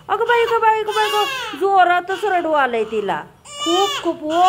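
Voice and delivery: playful mock sobbing; mock crying along. Real, not acted. Baby crying, mother sympathizes.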